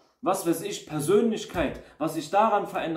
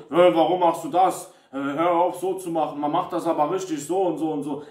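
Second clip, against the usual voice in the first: louder, mock-annoyed scolding; bossy nagging critic; 4 dB louder than usual. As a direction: nag like a know-it-all coworker. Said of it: "der Ton sch-, macht die Musik".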